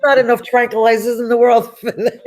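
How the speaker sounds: half-laughing mock despair; laughing exasperation. Retelling her freaked-out reaction.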